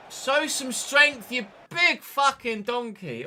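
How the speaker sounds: slow, high, loud scolding; loud drawn-out frustrated insult; exasperated berating. Real, not acted. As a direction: stretch the words out as you berate him. Berating his own player.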